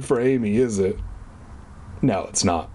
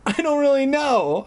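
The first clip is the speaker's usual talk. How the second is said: high-pitched, distressed reply; lightly hammed-up high distress; 11 semitones higher than usual. A narrator's reading. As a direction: answer high and upset. Narrator's dialogue after the party went wrong.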